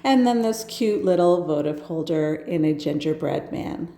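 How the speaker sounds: normal talking voice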